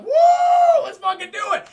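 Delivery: long, very high, loud whoop into a yell; wild whooping shout. Acted. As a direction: holler like you're setting off the explosives. Acting out crew man gleefully setting off explosives.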